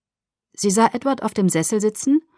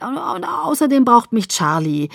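higher, louder insistent pleading; hurried argument; 6 semitones higher, 4 dB louder than usual. Acted. Daughter arguing to stay in Forks, hastily insistent. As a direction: scramble for a reason, pressing it hard.

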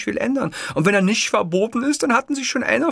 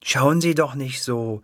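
lower, slow, comforting; motherly comfort; 7 semitones lower, slower than usual. Acted. Clerk comforting the downcast Hitler, motherly. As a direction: soothe him like a mother. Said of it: "mit einem tröstlichen, nachgerade mütterlichen Tonfalle".

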